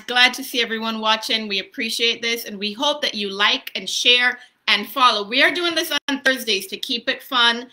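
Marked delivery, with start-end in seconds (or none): none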